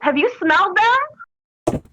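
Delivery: mock-sunny comeback with a big smile; sunny sarcasm. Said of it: "I'm like".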